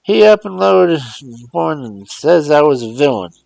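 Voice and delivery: put-on high, loud old man's dialect voice; old servant astonished at the talking box; amazed old Uncle Remus. Uncle Remus asks Mars John through the phone, amazed.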